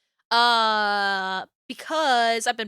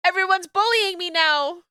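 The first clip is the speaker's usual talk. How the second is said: ordinary talk, nothing marked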